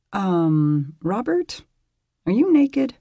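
high, startled, hesitant asking; surprised question. Acted. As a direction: blurt it out in surprise. Woman asks shy surprised question.

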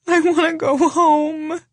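near tears. Acted. Janine about to cry.

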